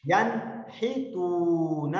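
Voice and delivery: ینحتون drawn out with a clear n; recited very slowly. Shown as a demonstration. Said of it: "آپ میرے ساتھ پڑھیں". (ینحتون صاف نون کے ساتھ آہستہ)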